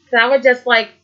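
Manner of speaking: ordinary delivery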